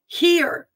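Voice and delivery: "Hear" high, falling, set apart; exclaimed Hear. Real, not acted. Urging people to heed the Spirit, "Hear" exclaimed. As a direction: call out "Hear" as an emphatic command. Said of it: "exclamation point, that's capitalized".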